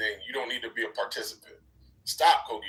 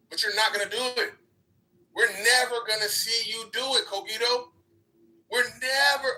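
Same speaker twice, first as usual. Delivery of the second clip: mocking taunt; 7 semitones higher than usual. Real, not acted. Mocking rant at Cogito.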